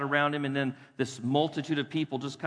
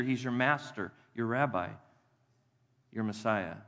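ordinary talk

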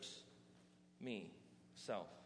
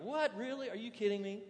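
put-on high, incredulous teen outburst with laughs; mock disbelief; 6 semitones higher than usual. Preacher recalling his teenage self, laughing in disbelief. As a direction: play your younger self aghast at the rules. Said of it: "I was like".

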